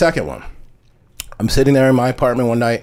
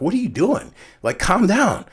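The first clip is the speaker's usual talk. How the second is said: high-pitched, bewildered protest; mock-startled, higher than usual; 10 semitones higher than usual. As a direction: retell fending someone off, voice pitched up. Fending off a drunken friend's kisses.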